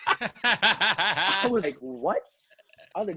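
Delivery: real laughter through the words; cracking up mid-story; laughing. Real, not acted. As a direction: laugh as you tell it. Laughing while retelling.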